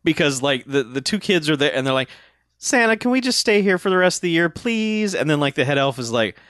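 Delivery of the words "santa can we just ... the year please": put-on pleading child voice. Kids begging, quoted.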